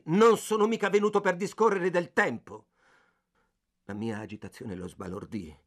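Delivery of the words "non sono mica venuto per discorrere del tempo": higher and louder, yelling in outrage; furious shout. A narrator's reading. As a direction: shout it at the shop clerk, enraged. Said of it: "Infuriato gridai".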